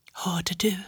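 soft, toneless whisper. A narrator's reading. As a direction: breathe it, barely audible. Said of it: "viskade Elsa till mig".

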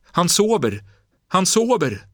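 high, loud cries of relief; jubilant shout. Acted. Boy tells grandmother the man sleeps, jubilant shouting. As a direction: burst out twice with joy. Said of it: "skrek pojken jublande".

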